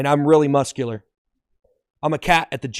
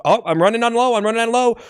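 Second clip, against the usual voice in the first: high-pitched pretend alarm; hammed-up worry as the tank empties; sudden raised-pitch fretting; mock panic; 7 semitones higher, 4 dB louder, faster than usual. Acting car running out of gas.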